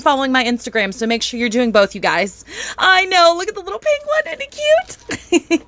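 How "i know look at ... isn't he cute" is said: overwhelmed by cuteness. Real, not acted. Gushing over penguin.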